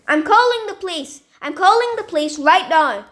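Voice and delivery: high, loud threat; shouting threat. Acted. Stephanie threatening the man at the door, loud shout. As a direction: yell it to scare him off.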